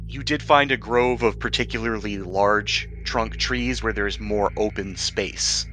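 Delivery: ordinary talk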